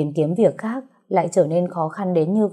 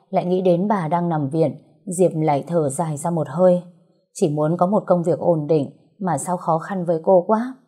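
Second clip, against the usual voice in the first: usual speaking voice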